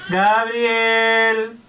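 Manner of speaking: normal talking voice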